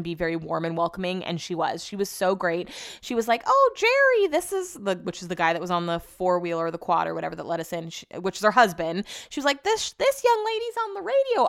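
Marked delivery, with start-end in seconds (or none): 3.5-5.0 very high imitated country accent. Dog breeder calls to her husband, hammed-up country accent. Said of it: "she has a country accent". 9.6-11.5 very high, put-on country accent. Dog breeder introduces visitor to husband, mock country accent. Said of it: "I don't know why she has a country accent".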